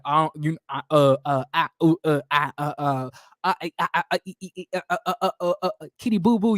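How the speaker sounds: stammering. Retold or imitated. Bernard stammering when confronted.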